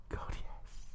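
very soft acted whisper; lover's faint whisper; whispered reply. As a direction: answer in a hushed, voiceless breath. Lover's reply, voiced by narrator.

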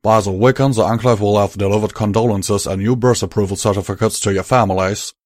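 loud, firm Southern cowboy, sorry underneath; Southern drawl. Acted. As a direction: break the news plainly, with regret. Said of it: "Calamity continued".

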